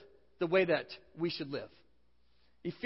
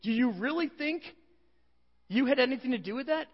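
Paul rebuking; 5 semitones higher than usual. Retold or imitated. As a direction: scold them like they can't be serious. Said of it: "He says".

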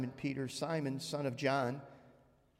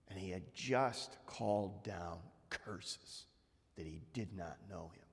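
hushed low; 7 semitones lower, 8 dB quieter than usual. Real, not acted. Preacher hushes as Peter meets Jesus' eyes. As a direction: let it sink low and quiet. Somber hush before whisper.

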